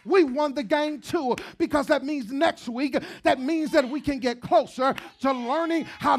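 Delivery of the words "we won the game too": normal talking voice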